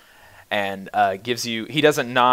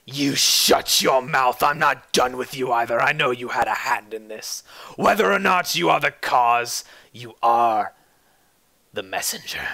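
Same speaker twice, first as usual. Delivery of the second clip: menacing threat. Acted. Roderick threatening the player.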